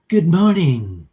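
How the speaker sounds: slow, drawn-out judge greeting; hammy courtroom opener; mock judge voice. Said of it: "No, I'm kidding".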